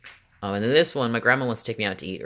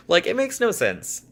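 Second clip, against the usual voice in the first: baffled; 9 semitones higher than usual. Real, not acted. Still exasperated after laugh.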